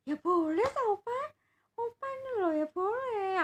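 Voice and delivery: put-on high, loud and slow, an exasperated little girl; annoyed child voice. Granddaughter insists to grandpa he may buy it. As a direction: whine it like a fed-up kid.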